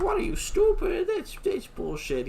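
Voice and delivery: high-pitched, indignant Asuka impression; hammed-up scolding shriek; mock outraged squawk. Asuka denying they're a couple, mock outraged squawk.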